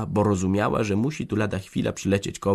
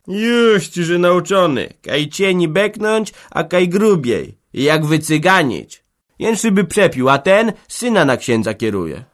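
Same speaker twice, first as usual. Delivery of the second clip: raised voice scoffing at the organist; mocking villager; 10 semitones higher, 7 dB louder than usual. Villager scoffs at the organist's singing, mocking. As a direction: sneer at his learning.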